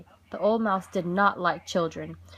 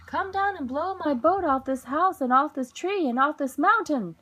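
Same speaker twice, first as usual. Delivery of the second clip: loud, higher call to the wind; shouting; 6 semitones higher, 4 dB louder than usual. A narrator's reading. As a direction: shout it up the mountain. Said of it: "shouted the mouse".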